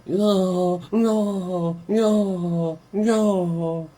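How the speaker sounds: high mimicking no no no. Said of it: "[name]'s still screaming, going".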